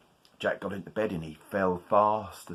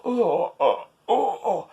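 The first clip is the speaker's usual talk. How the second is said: higher eager oh oh; excited Jack; 11 semitones higher, 4 dB louder, much slower than usual. Acted. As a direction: bubble with excitement.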